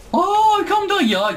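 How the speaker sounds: sudden high yelp of alarm; high startled gasp of words; startled cry. Real, not acted. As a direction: jump at the enemy behind you. Startled by enemy behind.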